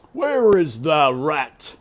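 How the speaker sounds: slow, loud, furious royal bellow; king's shout. A narrator's reading. Grieving king shouts at his court, loud and slow. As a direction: roar it out as the enraged king. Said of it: "shouted the king".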